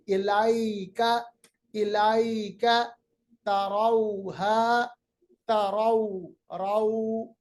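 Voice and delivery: इलाइका and तरौहा slow, vowels drawn soft. Shown as a demonstration. Teacher drills soft vowels slowly. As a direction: stretch the diphthongs gently. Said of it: "इन्हें बड़ा मुलायम आवाज निकालना है".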